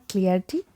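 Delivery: clarity as CLEAR-tee; mispronunciation. Shown as a demonstration. Teacher rejects one wrong version, mispronounced clarity. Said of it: "So it is neither".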